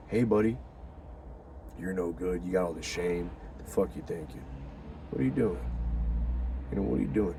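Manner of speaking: low, soft taunting devil on the shoulder. Acted. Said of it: "The devil on your shoulder".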